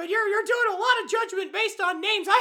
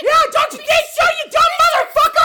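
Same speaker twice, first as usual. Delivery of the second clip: loud, higher hammed-up yell; enraged shout; 5 semitones higher, 7 dB louder than usual. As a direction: round on your rival, shouting. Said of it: "so consumed with rage".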